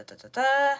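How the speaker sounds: usual speaking voice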